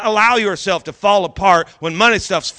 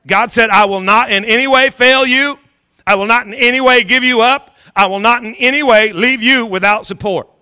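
preaching at full force; 3 semitones higher, 4 dB louder than usual. Acted. Pastor declaring God's promise to his church, forceful preaching. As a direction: proclaim the promise to the congregation.

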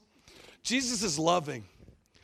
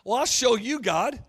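louder, cocky challenge; defiant retort; 4 semitones higher, 7 dB louder than usual. Retold or imitated. Preacher accepting God's challenge, loud defiant retort. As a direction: answer God with a dare. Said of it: "It was like".

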